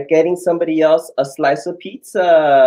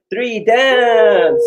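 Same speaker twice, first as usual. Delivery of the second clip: excited count-in; 7 semitones higher, 4 dB louder than usual. Real, not acted. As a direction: count then burst out on dance, higher. Counting into a dance.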